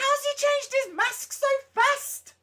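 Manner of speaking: high disbelief. Retold or imitated. Retelling his confusion.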